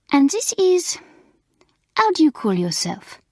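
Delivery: put-on high, lilting French accent; French teacher asking the new girl her name; French mistress voice. Mamselle voice.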